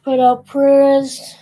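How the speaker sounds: loud solemn words. Real, not acted. Offering condolences.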